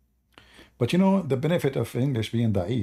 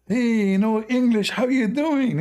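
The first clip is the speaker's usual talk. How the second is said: buddy greeting; 11 semitones higher, 4 dB louder, slower than usual. Retold or imitated. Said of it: "So he said".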